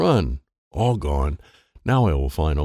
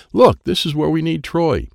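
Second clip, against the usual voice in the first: excited; 9 semitones higher, 5 dB louder than usual. A narrator's reading. Excited discovery.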